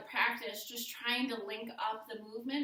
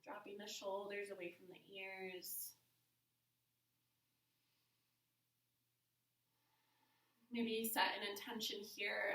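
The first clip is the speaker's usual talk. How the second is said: guided meditation; 8 dB quieter than usual. Real, not acted. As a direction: ease them softly through each breath. Leads breathing meditation.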